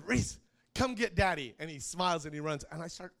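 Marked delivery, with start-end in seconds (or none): 0.1-1.5 high, louder playful call across the room. Retold or imitated. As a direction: call the boy over, teasing. Calling son to come get him.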